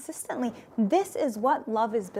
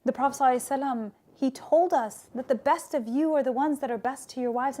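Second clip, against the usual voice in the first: ordinary delivery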